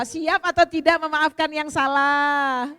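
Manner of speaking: loud and slow, each word drawn out; preacher pressing the crowd to commit; slow rallying call. Real, not acted. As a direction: stretch the question to demand an answer. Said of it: "repeats call to audience".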